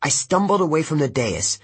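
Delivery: ordinary delivery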